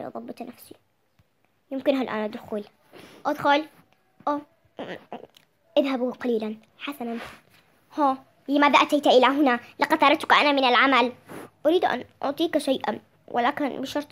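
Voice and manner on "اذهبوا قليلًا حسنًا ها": usual speaking voice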